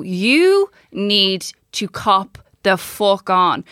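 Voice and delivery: slow, drawn-out telling-off; stern self-talk. Retold or imitated. Speaker giving themselves a talking-to, slow and stern. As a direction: stretch each word out firmly. Stern word to oneself, retold.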